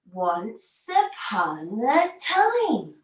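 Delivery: very slow, drawn-out storybook opening; slow story opening. Shown as a demonstration. Teacher opening the story for kids, very slow. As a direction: stretch each word like opening a magic book. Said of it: "all our stories start in the same way".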